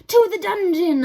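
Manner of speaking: high, loud angry cry from the crowd. A narrator's reading. Angry mouse in the crowd, crying out. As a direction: call for his punishment. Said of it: "a voice cried out".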